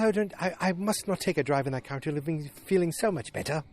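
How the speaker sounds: high put-on voice for an imitated wife; mock-wifely, high-pitched prattle; wife's voice. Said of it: "She goes".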